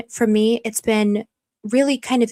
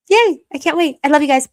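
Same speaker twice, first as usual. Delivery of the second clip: much higher and louder happy yay; a high gleeful yay; excited cheer; 6 semitones higher, 4 dB louder than usual. Real, not acted. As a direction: squeal it with delight.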